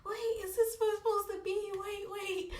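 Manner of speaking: high panicked gasping pleas. Retold or imitated. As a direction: gasp and plead in panic. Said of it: "And I was panicking".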